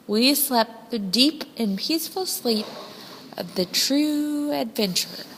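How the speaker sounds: ordinary delivery, nothing marked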